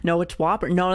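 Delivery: usual speaking voice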